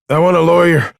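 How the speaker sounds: high, loud protest from a captive; loud demand. A narrator's reading. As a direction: call out a defiant demand. Arrested man demands a lawyer.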